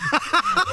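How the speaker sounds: short squealing laugh; high laugh. Real, not acted. Salesman laughs recalling the prank on truckers.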